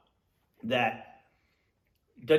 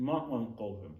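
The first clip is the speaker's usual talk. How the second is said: talking with mouth full; 3 semitones lower, 5 dB quieter, slower than usual. Real, not acted. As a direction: say it through a mouthful. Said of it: "Let me not talk with my mouth full".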